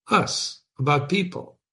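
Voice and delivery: normal talking voice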